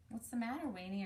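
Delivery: plain reading voice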